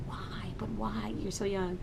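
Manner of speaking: whispered disbelief; a soft despairing plea; hushed "why" of dismay. Retold or imitated. Dismayed mom to son.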